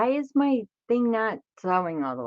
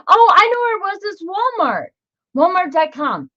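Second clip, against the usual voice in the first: loud, high burst of remembering; sudden excited realization; 12 semitones higher, 10 dB louder than usual. Real, not acted. Crafter suddenly remembers the store for viewers, excited outburst. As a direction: cry it out as it suddenly comes back to you.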